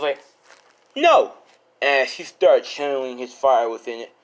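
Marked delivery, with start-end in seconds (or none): 1.0-1.3 loud, high-pitched cry of refusal; defiant shout. Acted. Acted refusal before a fight.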